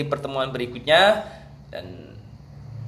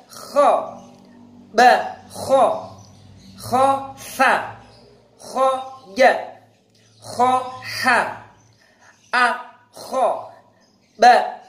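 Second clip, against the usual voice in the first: higher, clear, measured letter-by-letter chanting; recitation drill; 9 semitones higher, much slower than usual. Acted. Teacher drilling students on kha syllables, chanted and high. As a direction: call each syllable out for students to repeat.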